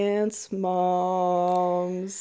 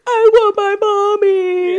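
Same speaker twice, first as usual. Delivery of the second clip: put-on high, slow, louder childish wail; whiny kid voice; 12 semitones higher, 8 dB louder, slower than usual. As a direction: wail like a scared little kid. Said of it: "he's like".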